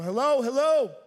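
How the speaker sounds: loud call into the house, rising in pitch; nervous calling out. Retold or imitated. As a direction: call out to a possible intruder. Calling out to intruder.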